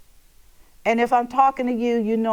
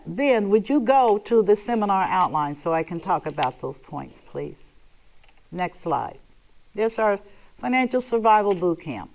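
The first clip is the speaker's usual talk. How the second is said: ordinary delivery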